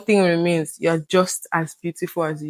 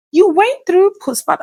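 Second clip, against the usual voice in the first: put-on high, louder American-accented question; mimicked American accent; 12 semitones higher, 5 dB louder than usual. Friend in America questioning a new mother, mimicked American accent. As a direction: imitate your friend's American accent, pitched up. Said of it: "in her accent, I tried to mimic her".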